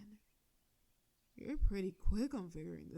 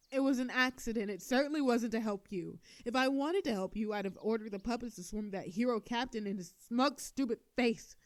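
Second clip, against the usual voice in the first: loud flustered insistence; flustered denial; 2 semitones higher, 5 dB louder than usual. Acted. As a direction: protest too much, rattled.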